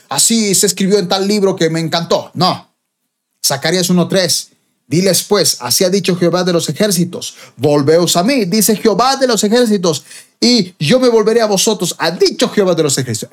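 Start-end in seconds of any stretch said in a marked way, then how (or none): 4.9-13.3 emphatic reading, rising higher as it goes; prophetic scripture proclamation. Acted. Preacher reads Zechariah to listeners, building prophetic proclamation.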